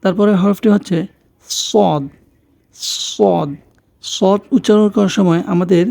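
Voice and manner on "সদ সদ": সদ with a long, heavy s; সদ as emphatic "ssod"; heavy Arabic Sad. Shown as a demonstration. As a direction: demonstrate সদ, tongue side against the molars. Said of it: "জিহ্বার দুই পাশে".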